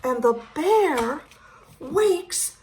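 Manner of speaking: excited storybook build-up; rising excitement. A narrator's reading. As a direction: tell it like the big moment. Dramatic bear wake-up.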